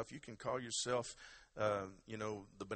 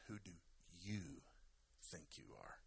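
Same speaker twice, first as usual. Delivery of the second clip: "you" set apart by a pause, the rest low; stress on YOU; 5 semitones lower, 13 dB quieter than usual. Shown as a demonstration. Preacher names this morning's topic, stress on YOU. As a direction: pause before "you", then drop away softly. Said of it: "what I'm gonna talk about this morning".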